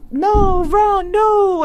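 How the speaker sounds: a mock Harry Potter British voice; Harry Potter accent. Teacher imitating her student's accent game: Harry Potter British English. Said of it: "the Harry Potter accent".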